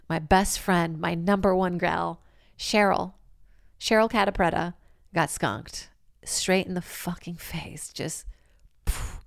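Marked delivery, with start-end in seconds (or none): 5.1-9.2 whisper. Real, not acted. Dramatic reveal of the skunking.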